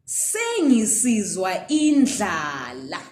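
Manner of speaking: Sengisizwa indlala slowly, ending in a rise; slow sentence demo; slow example sentence. Shown as a demonstration. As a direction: say it slowly for the learner. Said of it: "you can hear someone saying".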